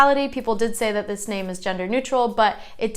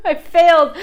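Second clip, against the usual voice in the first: high, loud laughing admission; 6 semitones higher, 5 dB louder than usual. Real, not acted. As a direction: laugh helplessly at your own mistake. Laughs at own pronunciation confusion.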